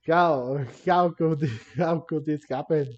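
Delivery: ordinary talk, nothing marked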